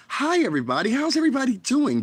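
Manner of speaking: very high, sugary mock greeting; fake sweet streamer voice. Vlogger mocking a fake streamer's sugary greeting, very high. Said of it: "hear a motherfucker talk like this".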